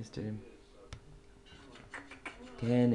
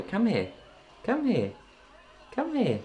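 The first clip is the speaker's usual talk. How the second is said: high sing-song call, climbing each time; calling a pet; 10 semitones higher than usual. Real, not acted. Owner calling a parrot over, increasingly high pet call. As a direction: beckon a bird, higher with every repeat.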